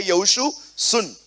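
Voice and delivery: loud, high commanding divine voice; voice of God. Acted. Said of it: "परमेश्वर यहोशू से कहता है".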